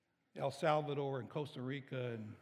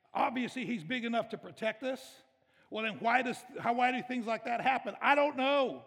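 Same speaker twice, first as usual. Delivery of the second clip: loud, raised, driving sermon voice; impassioned preaching; 6 semitones higher, 7 dB louder than usual. Acted. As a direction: preach with full force to the congregation. Preaches at full force.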